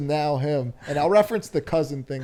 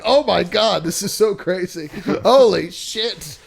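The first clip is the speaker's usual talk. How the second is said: loud, high amazed shout; mock-amazed old-timer yelling; amazed exclaim; 5 semitones higher, 6 dB louder than usual. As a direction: gasp it out like you've never seen it. Acting amazed basketball watchers.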